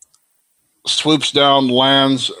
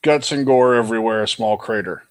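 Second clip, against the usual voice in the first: normal talking voice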